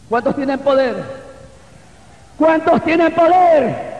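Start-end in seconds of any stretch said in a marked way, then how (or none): 2.4-3.9 high, slow rallying call; arenga a la congregación. Real, not acted. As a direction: rouse the church with a raised, stretched question. Repeats the question to rouse congregation. (arenga en voz alta, aguda y alargada)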